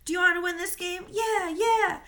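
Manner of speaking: loud, high team cheer; yelling. Acted. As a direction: cheer back at full voice. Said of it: "we started yelling".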